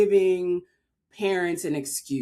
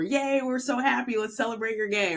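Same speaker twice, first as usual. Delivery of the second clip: higher, cheery imagined cheering; mock celebration; 5 semitones higher than usual. Imagined family cheering the coming out, mock celebration. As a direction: act out a delighted welcome.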